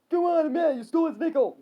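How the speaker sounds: high, loud accusation; yelling back. Acted. Billy accusing Frank, high yelling. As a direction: yell it straight back. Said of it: "Billy yelled back".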